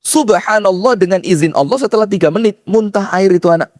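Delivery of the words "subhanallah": usual speaking voice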